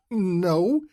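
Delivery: bursts out laughing, then a high No; loud laugh. Acted. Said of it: "She laughs loudly".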